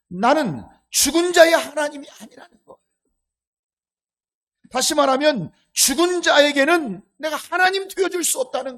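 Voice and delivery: 높고 크게 외치는 설교조; 회중을 향해 힘주어 선포하듯. Acted. (목청 높여 강하게 설교하기)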